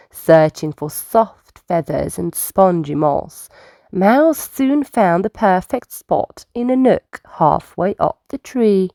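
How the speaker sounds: plain reading voice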